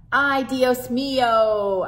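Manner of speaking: slow, loud dismayed "Ay, Dios mío"; drawn-out grandmotherly dismay; exasperated sigh. Acted. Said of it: "Abuela said".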